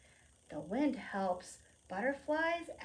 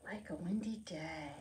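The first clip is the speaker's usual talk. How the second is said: whispered title; 2 semitones lower, 6 dB quieter than usual. Shown as a demonstration. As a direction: whisper Like a windy day softly. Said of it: "title whispered after plain read".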